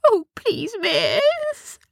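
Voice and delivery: high desperate begging. Acted. Gnomes begging Sophia not to take the light, high. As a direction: plead for mercy. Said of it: "begged the gnomes".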